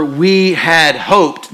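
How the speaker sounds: very slowly, "we had hoped" with weight on "hoped"; slow, stressed on hoped. Shown as a demonstration. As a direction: lean hard on the "D" at the end of "hoped". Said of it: "takes the D off the end of hope".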